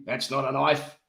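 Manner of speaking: attempted Australian film-quote accent; Crocodile Dundee. Quoting the film to co-host, mock Australian accent. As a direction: put on a mock Aussie accent. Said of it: "Crocodile Dundee".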